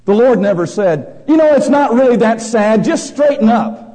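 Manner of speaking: loud, high sermon voice, pressing each phrase; full-force preaching. Acted. Preacher to congregation, loud fervent preaching. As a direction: thunder it from the pulpit.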